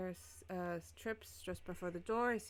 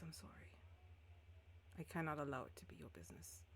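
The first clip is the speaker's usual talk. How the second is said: whispered apology; 2 semitones lower, 7 dB quieter than usual. Acted.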